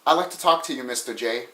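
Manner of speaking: softly spoken, lightly hinted New York voice; a cute little New York request, trailing down very quiet; touch of cute New York, kept soft. Acted. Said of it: "in a cute little New York voice says".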